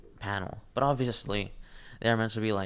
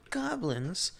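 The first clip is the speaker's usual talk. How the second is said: much higher, slowed, scoffing character voice; mocking high voice; 11 semitones higher than usual. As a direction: sneer at the goblins in a squeaky put-on voice. Mocking voice dismisses goblins.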